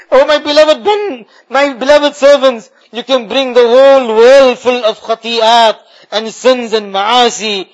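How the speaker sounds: normal talking voice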